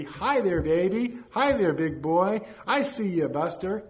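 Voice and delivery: high, loud, playful cooing call; greeting a baby. A narrator's reading. Old man greets a stranger's baby across a restaurant, playful high call. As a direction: sing out a hello to a baby. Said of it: "His hands waved at my baby".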